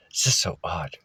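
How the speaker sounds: whispered to yourself; a hushed puzzled whisper. Real, not acted. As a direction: breathe it as a private aside. Muttered aside to self.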